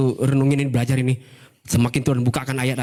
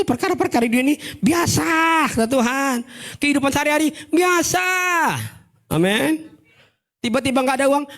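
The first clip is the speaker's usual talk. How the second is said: preaching at full force; 12 semitones higher than usual. Acted.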